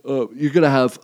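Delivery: talking mid-yawn. Real, not acted. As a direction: yawn stretching the words. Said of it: "I'm yawning".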